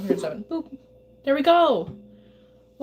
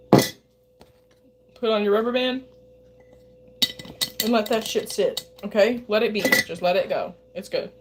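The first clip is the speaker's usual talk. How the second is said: usual speaking voice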